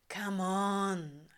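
coaxing, vowels stretched long; drawn out vowels. A narrator's reading. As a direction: drag every vowel to coax him. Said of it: "dragging out the vowels".

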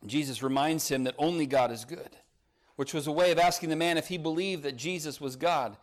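ordinary delivery

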